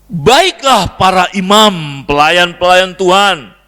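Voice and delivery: loud, slow, drawn-out scripture proclamation; preaching at full force. Real, not acted. Preacher summoning priests to repentance, loud solemn proclamation. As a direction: proclaim it to the whole congregation.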